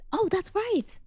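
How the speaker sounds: high squeak of remembering; oh it just clicked; sudden realization. Real, not acted. Sudden recollection.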